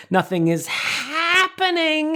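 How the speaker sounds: high, slow, held H in "happening"; high cartoon bird voice, holding the H; Scuttle impression. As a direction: stretch "happening" like a cartoon seagull. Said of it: "He holds the H".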